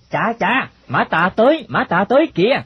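high-pitched lookout's alert; urgent warning. Acted. Boy calls out warning of police.

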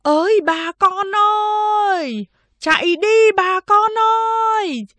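high, long-drawn cry to the villagers; loud shouted warning. Acted. Man on the tower urging villagers to flee, shouting. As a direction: call out the alarm from far up, stretching the vowels. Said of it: "người đàn ông lại hét to".

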